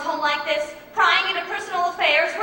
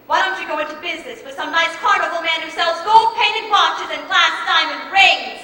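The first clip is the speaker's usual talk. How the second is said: louder, rising anger; scornful rebuke; 6 dB louder than usual. Acted. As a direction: tell the swindler off with contempt. Sister scornfully rebuffs the salesman.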